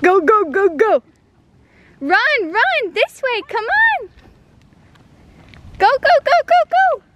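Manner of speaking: high excited shouts while running; being chased. Real, not acted. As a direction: cheer him on mid-chase. Chasing the toddler, calling.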